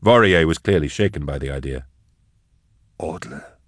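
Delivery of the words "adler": breathy, unvoiced whisper of disbelief; a shocked, toneless whisper. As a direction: barely breathe the name, stunned. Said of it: "he whispered".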